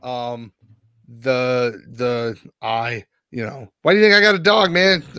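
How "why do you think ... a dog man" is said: mock indignant. Mock defensive retort.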